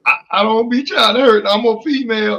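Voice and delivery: high, loud mock pleading; mock-innocent caller, high and insistent; imitated female caller. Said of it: "the second phone call was".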